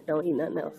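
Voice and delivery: ordinary talk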